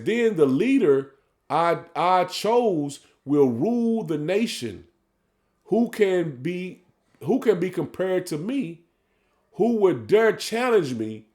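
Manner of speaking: measured, drawn-out scripture reading; solemn sermon reading; slow preaching scripture read. Acted. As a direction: lay each phrase out slowly like a preacher.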